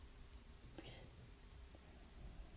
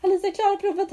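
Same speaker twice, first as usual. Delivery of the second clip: higher and louder, proudly announcing it; proud announcement; 9 semitones higher, 4 dB louder than usual. Retold or imitated. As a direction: brag to dad that she passed. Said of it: "jag bara".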